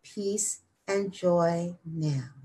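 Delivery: slow, soft meditative affirmation; unhurried mantra with long final words; slow repetition. Shown as a demonstration. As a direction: let each phrase settle, drawn out and hushed. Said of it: "And then slowly repeat".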